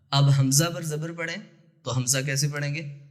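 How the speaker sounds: usual speaking voice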